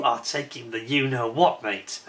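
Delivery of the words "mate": ordinary delivery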